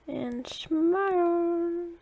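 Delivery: slow, high, drawn-out cue; singsong, held say-cheese prompt; photographer's cue. Real, not acted. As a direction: stretch it out while lining up a photo. Posing dogs for a thumbnail photo.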